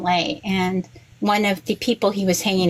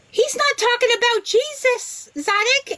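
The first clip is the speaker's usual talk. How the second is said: much higher, mock-exasperated correction of her brother; exasperated retort; 14 semitones higher than usual. Mother correcting her brother Zadok about the Moshiach, exasperated high retort. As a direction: set him straight, half laughing in disbelief.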